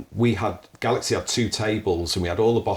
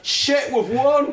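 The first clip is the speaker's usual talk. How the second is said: much higher, shrieking joy at winning; ecstatic scream; 4 dB louder, much slower than usual. Retold or imitated. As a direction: scream it like the table hearing they won. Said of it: "this piercing scream".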